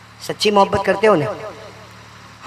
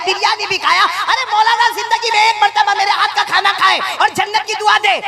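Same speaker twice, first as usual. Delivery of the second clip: rising higher and higher, scolding in disbelief; indignant scolding wife; 10 semitones higher than usual. Acted. Wife scolding husband who never blessed her cooking, indignant. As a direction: berate him, pitch climbing with each dish.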